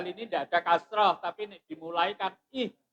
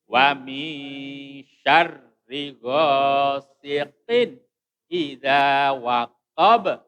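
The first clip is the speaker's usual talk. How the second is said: slow, loud, measured recitation; Quran recitation; 9 dB louder, much slower than usual. Shown as a demonstration. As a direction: hold the long vowels and land firmly on the endings.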